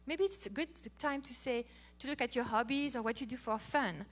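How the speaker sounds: ordinary talk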